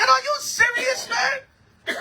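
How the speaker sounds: high, laughing are-you-serious squeak; mock disbelief. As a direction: squeal it in fake shock through a laugh. Mock disbelief at drive-by idea.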